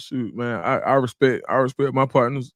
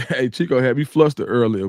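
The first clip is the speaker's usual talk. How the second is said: ordinary talk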